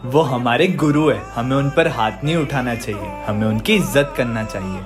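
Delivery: sincere lecture. Acted. Student lecturing about respect.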